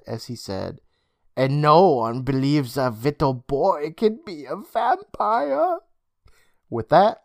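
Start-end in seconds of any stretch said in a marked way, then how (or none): 1.4-5.8 put-on slow, accented vampire with rising relish; hammed-up sinister vampire accent. A narrator's reading. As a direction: draw it out toward a high finish. Gleeful vampire Mr. Braun.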